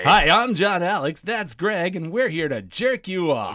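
loud, high radio DJ intro; booming announcer. Pretend. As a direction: announce it big and brassy. Hosts mock radio intro.

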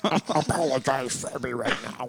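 squeaky gremlin voice, laughing; laughing little demon voice; demonic gremlin voice. Pretend. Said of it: "that sounds demonic".

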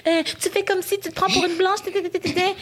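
reproche imité, aigu. Retold or imitated. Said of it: "j'ai pas envie qu'on me dise".

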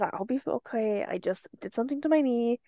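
softly quoted brush-off; retold reassurance. Retelling own reply to coworker.

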